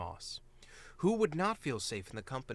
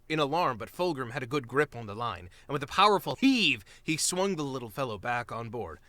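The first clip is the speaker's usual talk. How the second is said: ordinary delivery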